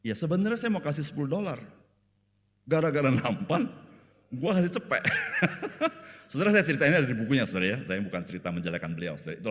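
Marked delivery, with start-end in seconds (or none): 0.0-5.0 higher-pitched comic grumble breaking into laughter; lightly hammed-up complaint, raised pitch; mock complaining. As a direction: imitate an aggrieved husband grumbling. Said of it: "Kamu ngeluh apa sih?".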